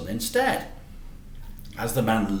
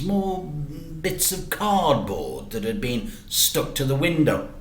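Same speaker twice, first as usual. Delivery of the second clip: plain reading voice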